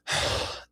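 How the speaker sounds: imitated exasperated sigh; audible sigh. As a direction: let out a big mock sigh. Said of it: "he audibly goes".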